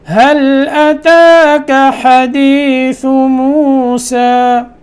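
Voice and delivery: slow, melodic recitation for repetition; Quran recitation. Shown as a demonstration. Teacher reciting first so students read along. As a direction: recite slowly so the class can follow. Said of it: "मैं पढ़ाता हूँ".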